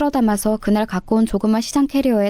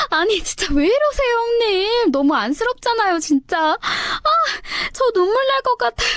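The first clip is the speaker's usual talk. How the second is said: much higher, laughing through the words, mocking; mocking laughter; 11 semitones higher than usual. Sister-in-law mocks the narrator while laughing, much higher. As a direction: sneer while giggling, pretending pity. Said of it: "진짜 눈도 안 보이게 웃어가면서 배를 잡더라고요".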